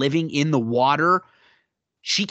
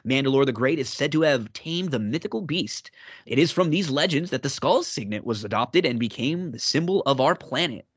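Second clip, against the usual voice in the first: ordinary talk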